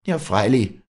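high, loud hateful retort; erupting hatred. A narrator's reading. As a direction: burst out with scorn. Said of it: "rief die Walli mit ausbrechendem Hass".

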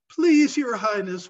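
distraught plea to the queen; pleading. A narrator's reading. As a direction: beg the queen for mercy. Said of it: "pleaded the distraught man".

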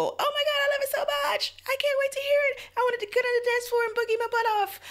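very high, fast, gushing squeal; breathless high-pitched fangirl gush; ecstatic squeal. Retold or imitated. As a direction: squeal it like you can't contain yourself. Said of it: "make you just, just go".

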